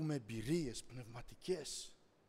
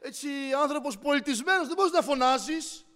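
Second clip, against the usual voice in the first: lightly acted, higher-pitched reproach; scolding bystanders; 9 semitones higher, 11 dB louder than usual. Bystanders telling the shouting man to be civil, mock reproach. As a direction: stammer out a lightly mocked telling-off. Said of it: "οι άνθρωποι λέει".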